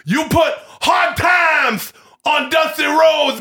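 high, shouted wrestling promo; Ric Flair promo. Retold or imitated. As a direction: yell it like a cocky wrestler cutting a promo. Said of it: "another wrestling impression".

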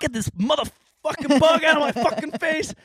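high, frantic swearing rant; furious yelling. Retold or imitated. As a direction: scream it like you lost it. Quoting the reporter breaking.

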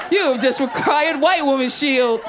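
video game item announcement. Acted. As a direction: announce a magic item grandly. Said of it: "picked up an item in Zelda".

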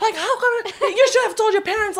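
high-pitched scolding with laughter underneath; freaking-out mom. Retold or imitated. Mother scolding her climber daughter by phone, retold in high mock panic. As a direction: imitate a worried mother protesting. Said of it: "she's freaking out".

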